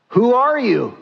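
high, sharp-falling question; startled father. Acted. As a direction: ask it high, as if taken aback.

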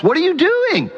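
high puzzled what are you doing; startled passerby; alarmed question. Retold or imitated. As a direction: ask it in shocked disbelief. Bystander questions Shlomo.

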